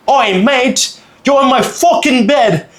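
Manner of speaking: high-pitched outraged British tourist impression; British accent. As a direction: put on an indignant British accent. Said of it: "react in the most British way possible".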